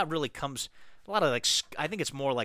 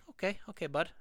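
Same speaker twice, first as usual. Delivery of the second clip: put-on light Canadian accent, higher pitched; 7 semitones higher than usual. Reading a podcast letter, trying a mild Canadian accent. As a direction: slip into a mild Canadian voice, bud. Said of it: "I'll try a Canadian".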